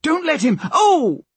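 high urgent shout breaking into a yelp; cut-off cry. Acted. Molly yanked by the hair mid-warning, pained cry. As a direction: cry out until pain cuts in. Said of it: "Slank had silenced her".

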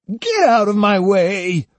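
high, stretched-out furious order; angry cry. A narrator's reading. Osugi shoving the boy aside, crying out angrily. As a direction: cry out slowly while shoving past. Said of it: "cried Osugi, shoving him aside".

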